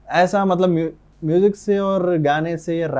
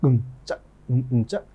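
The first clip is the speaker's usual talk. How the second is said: faster beatbox pattern; 4 semitones lower, 4 dB quieter than usual. Shown as a demonstration. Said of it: "तो उसको timing fast होता".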